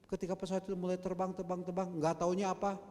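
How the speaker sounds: loud, high, driving sermon storytelling; fiery storytelling from the pulpit; impassioned preaching. Acted. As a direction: preach it out to the whole church. Preacher tells Challenger story loudly.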